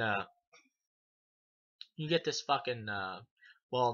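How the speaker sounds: usual speaking voice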